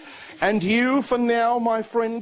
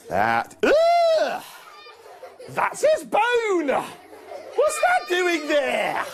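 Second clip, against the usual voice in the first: high, slow, hammed-up shocked disgust; mock disgust; 11 semitones higher, much slower than usual. Magician finds a bone in the bag in front of Paul, mock disgust. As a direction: pull a face of exaggerated shock, laughing.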